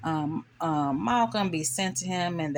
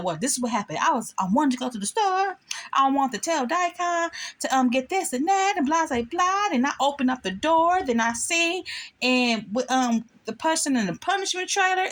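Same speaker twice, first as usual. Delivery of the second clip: much higher, breathless rambling; rambling flustered character; 9 semitones higher than usual. Retold or imitated. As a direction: tumble through the story without getting to the point. Rambling character telling the story.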